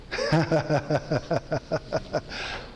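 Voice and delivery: hearty demo laugh; unconditional laugh. Shown as a demonstration. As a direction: laugh freely for nothing. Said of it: "What's unconditional laughter?".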